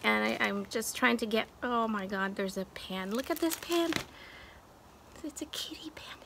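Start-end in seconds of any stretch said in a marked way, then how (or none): 5.2-6.2 awed unvoiced whisper; hushed thrill over the pan. Real, not acted. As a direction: breathe it out in delight. Excited over kitty pan.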